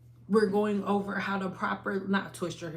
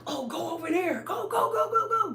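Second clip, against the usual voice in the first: high, loud frantic shouts of go; yelling at the movie; 12 semitones higher, 6 dB louder than usual. Retold or imitated. Shouting at a movie character to run, frantic and high. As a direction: cheer the character on like a movie watcher. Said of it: "you like".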